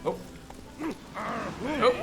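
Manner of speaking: ordinary talk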